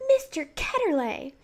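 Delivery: put-on girl suddenly remembers and shouts the name; shouting out. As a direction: blurt the name in recognition. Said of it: "she shouted out".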